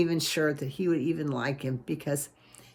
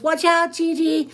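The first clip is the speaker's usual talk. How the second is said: high, louder warning; warning call; 10 semitones higher, 5 dB louder than usual. A narrator's reading. Brother warns sister on the stairs, high louder call. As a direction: call out quickly to stop a bump.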